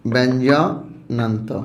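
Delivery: ব্যঞ্জনান্ত said slowly and clearly; slow model answer. Shown as a demonstration. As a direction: give ব্যঞ্জনান্ত slowly for them to repeat. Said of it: "কি বলতো?".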